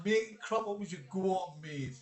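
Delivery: normal talking voice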